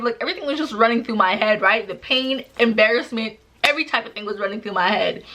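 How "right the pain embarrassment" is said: ordinary talk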